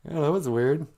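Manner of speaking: ordinary talk, nothing marked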